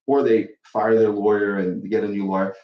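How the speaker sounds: usual speaking voice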